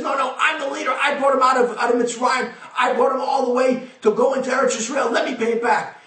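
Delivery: high, shouted claim of leadership; booming leader's boast; proud shout. Acted. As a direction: insist loudly, pulling rank. Moshe insists he should pay.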